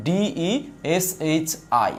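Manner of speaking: ডিইএসএইচআই spelled slowly, letter by letter; slow spelling. Shown as a demonstration. Teacher spelling দেশি in English letters. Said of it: "দেশি আরেকবার ভাঙুন না English ওয়েতে".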